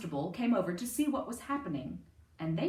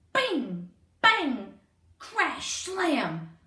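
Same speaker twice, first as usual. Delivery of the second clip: louder punchy sound words; loud noises; 5 semitones higher, 6 dB louder, much slower than usual. A narrator's reading. Digging racket in the cellar, loud bangs. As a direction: bang them out.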